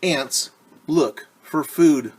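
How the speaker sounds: slow, loud, clear read-aloud; reading to children. Acted. Teacher reading the book's line to kids, slow and loud. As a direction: read it out slowly for little listeners.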